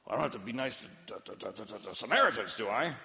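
low, hurried grumble with stammering; grudging mumble, stumbling over the words; reluctant objector. Acted. Preacher voicing a man reluctant to be nice, grudging.

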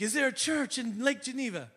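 put-on high-pitched Irish accent. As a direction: imitate an Irishman asking hopefully. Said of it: "He's got this great accent".

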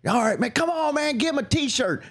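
high-pitched imitation of a pushy fan; pleading dad. Father begging for his boy's t-shirt, high pleading. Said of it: "His dad kept going".

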